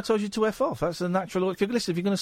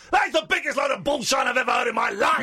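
furious shout; 9 semitones higher than usual. Real, not acted. Radio host exploding at a caller who called him abrasive, furious shouting. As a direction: blow up at the accusation.

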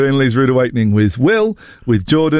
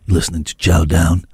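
low station-ident voice; mock late-night radio DJ; 11 semitones lower than usual. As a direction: drop deep to plug the show. Resumes the mock DJ voice.

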